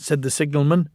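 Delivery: ordinary delivery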